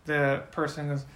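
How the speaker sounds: usual speaking voice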